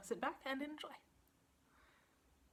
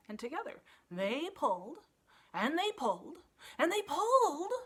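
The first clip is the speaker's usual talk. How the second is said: rising higher and louder each pull; building strain; 6 semitones higher, 8 dB louder than usual. A narrator's reading. Narrator building the pulling, much louder at the end. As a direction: heave harder each time.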